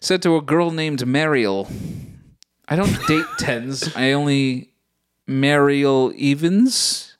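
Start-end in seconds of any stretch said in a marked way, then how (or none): 2.7-7.1 drawn-out name reveal; slow reveal. Acted. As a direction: stretch out the name slowly. Reading pickup line aloud.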